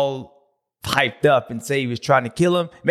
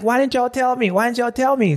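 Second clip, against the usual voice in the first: mock annoyed complaint, pitched higher; a rider sulking at his team, higher; imitating pissed-off rider; 9 semitones higher than usual. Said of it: "And he was pissed".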